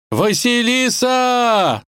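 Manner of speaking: long, high desperate shout; far-carrying drawn-out cry; calling out. A narrator's reading. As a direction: bellow the name, drawn out and way up high. Prince calls out for lost princess.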